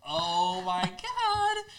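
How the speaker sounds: high, slow, stretched-out shocked gasp; shocked disbelief. Retold or imitated. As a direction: drag it out in laughing disbelief. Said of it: "I was like".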